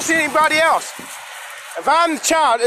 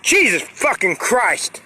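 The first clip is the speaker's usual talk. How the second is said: exasperated oath; 5 dB quieter, slower than usual. Real, not acted. Exasperated oaths with pauses.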